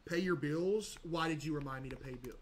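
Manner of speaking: ordinary delivery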